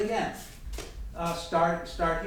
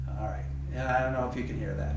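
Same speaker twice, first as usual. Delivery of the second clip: normal talking voice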